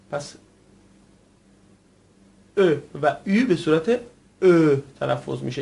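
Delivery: normal talking voice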